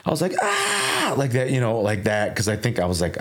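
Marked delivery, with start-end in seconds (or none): 0.4-1.1 high imitated scream; retold scream. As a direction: yelp it high and short, waking in shock. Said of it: "And I literally just screamed".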